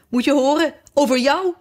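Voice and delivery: high, crowing announcement; triumphant exclamation. A narrator's reading. Fiedeldop reveals the dream to Alice, triumphant and high. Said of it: "riep Fiedeldop uit, triomfantelijk".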